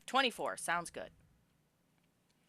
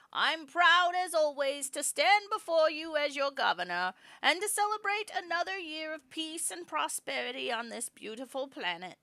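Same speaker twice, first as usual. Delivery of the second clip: aged governor's speech; 10 semitones higher, 7 dB louder than usual. Acted. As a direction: lift the voice thin and proud to the crowd.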